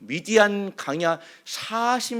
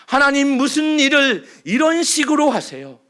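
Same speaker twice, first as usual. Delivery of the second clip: louder, pleading cry of protest; loud complaint; 5 semitones higher, 5 dB louder than usual. Acted. The congregation's grievance cried aloud to God. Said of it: "원망과 불평 아닌가요?".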